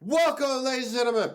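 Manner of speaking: big high show-host welcome; excited greeting. Acted. Host opening the video.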